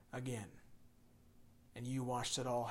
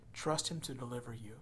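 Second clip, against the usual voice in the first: ordinary delivery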